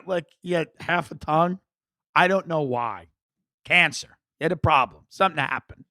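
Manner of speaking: normal talking voice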